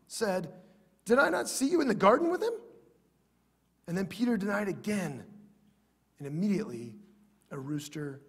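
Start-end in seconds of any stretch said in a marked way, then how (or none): 1.1-2.5 higher, louder, quick challenge; raised-voice challenge, quickened; accusing question. Acted. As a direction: confront him with a pointed, rising question. Accuser confronts Peter in Scripture.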